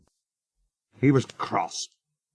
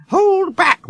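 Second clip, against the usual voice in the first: plain reading voice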